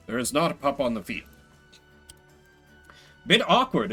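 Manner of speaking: ordinary talk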